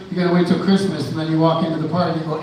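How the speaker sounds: ordinary talk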